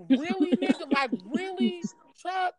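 mock outrage, up high: really, Chuck?; incredulous. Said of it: "I'm like".

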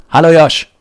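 big excited hello; loud greeting. Acted. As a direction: call out the name like a show host. Podcast host greets co-host loudly.

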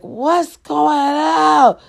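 high, slow calling mama. Retold or imitated. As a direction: imitate mom calling out with worry. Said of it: "My mama was like".

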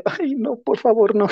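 high, pleading whine of dread; squeaky 'oh no, please' protest; mock dismayed plea. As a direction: beg off like a horrified student. Recalls dread of public class.

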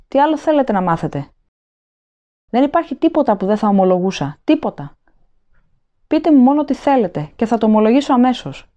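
high, urgent begging for mercy; desperate pleading. A narrator's reading. Prisoner begs the officer to spare him, desperate pleading.

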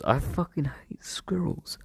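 hissed whisper of hatred. Real, not acted. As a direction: whisper it with venom. Venting hatred under breath.

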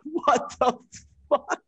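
shocked what the fuck. Retold or imitated. Told the NFL may sue, a loud stunned outburst. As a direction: yelp it out in disbelief.

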